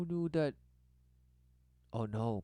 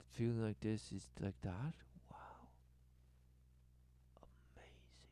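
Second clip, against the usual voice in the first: hushed mock amazement, fading to a whisper; awed whisper; 2 semitones lower, 9 dB quieter than usual. Voicing someone amazed at a feeling, whispering. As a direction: let it sink into a breath of wonder.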